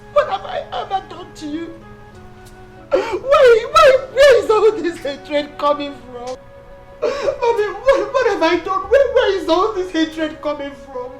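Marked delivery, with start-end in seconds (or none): 2.9-11.1 crying, raised voice; tearful pleading. Acted. Wife asking mother-in-law why she hates her, tearful pleading. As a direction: wail the question through sobs. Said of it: "use these crocodile tears to deceive me".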